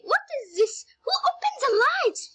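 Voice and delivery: high-pitched, alarmed question; startled girl. A narrator's reading. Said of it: "she said".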